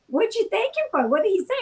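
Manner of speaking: mild mock confusion from a startled sister; confused sister. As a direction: sound baffled at the reply. Sister's confused questions retold.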